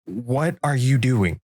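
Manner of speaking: slow, wary question; slow alarmed question. Retold or imitated. Asking the buddy holding a shovel, slow and wary. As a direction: ask it slowly, dreading the answer. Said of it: "And I was like".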